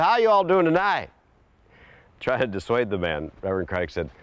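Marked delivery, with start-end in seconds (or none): 0.0-1.1 put-on high, louder friendly old-timer greeting; folksy old man. Old man greeting the preacher's table, high and hearty. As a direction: hail the table like a chatty old mountain man.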